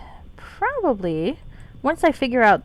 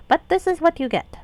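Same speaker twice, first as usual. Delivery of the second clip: high put-on pixie with a terrible accent; 9 semitones higher, 3 dB louder than usual. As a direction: pitch it up into a pixie. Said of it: "while doing the pixie voice".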